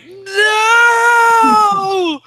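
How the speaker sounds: very high, loud cry of despair; anguished scream. Acted. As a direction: scream it as your heart breaks. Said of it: "and he just, like".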